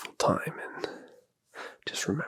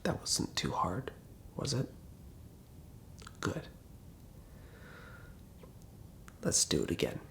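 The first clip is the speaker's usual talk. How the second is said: soft guided voice; 4 semitones lower, 4 dB quieter than usual. Acted. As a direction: coax the listener toward sleep. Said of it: "not your standard A S M R whisper voice".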